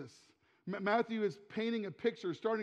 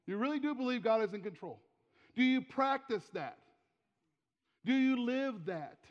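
ordinary talk, nothing marked

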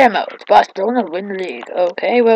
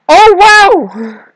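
loud, high exclamation of wonder; amazed outburst; 10 semitones higher, 7 dB louder than usual. Real, not acted. Player reacting to the game, loud amazement. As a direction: burst out with surprise.

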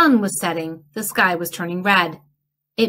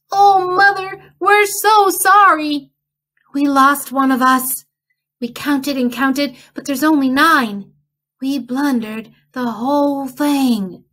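broken down crying; 7 semitones higher, 3 dB louder than usual. A narrator's reading. Children confessing to their mother, tearful and high. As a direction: confess it near tears. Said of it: "they broke down".

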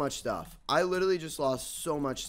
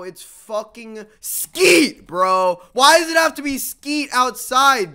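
loud exasperated yelling, high and slow; angry yelling; 11 semitones higher, 16 dB louder, much slower than usual. Real, not acted. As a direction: yell it at the screen in disbelief. Furious at rival player appearing.

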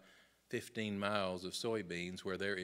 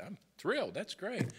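mock enthusiasm; 10 semitones higher than usual. Feigned enthusiasm to client.

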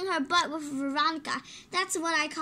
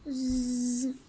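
low buzzing "zzz"; long, low sleep noise; imitated snoozing; 6 semitones lower than usual. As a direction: buzz like a cartoon sleeper.

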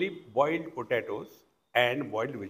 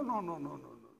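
ordinary talk

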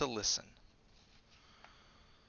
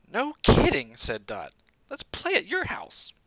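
spirited little bunny; 9 semitones higher, 10 dB louder than usual. A narrator's reading. Dot answers her friends.

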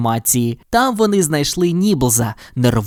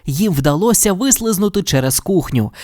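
plain reading voice

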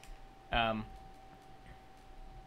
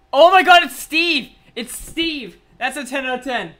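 real excited outburst; 5 semitones higher, 9 dB louder than usual. Real, not acted. Streamer seeing Steve revealed, excited outburst. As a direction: burst out high and loud, then ease slightly.

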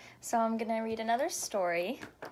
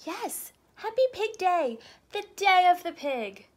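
high, louder pig voice; excited Piggie; 9 semitones higher, 8 dB louder than usual. Acted. Pig explaining her holiday to the elephant, giddy. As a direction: gush it like a giddy little pig.

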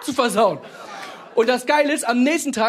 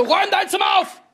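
mother yelling; 9 semitones higher than usual. Retold or imitated. Mother yelling at her son to tidy his room. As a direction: yell the command at the kid. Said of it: "die brüllt mich auch immer an".

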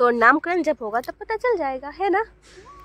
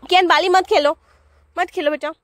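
higher, louder call to her son; 5 semitones higher, 4 dB louder than usual. Real, not acted. Mother calls Kiyan away from the bucket, raised.

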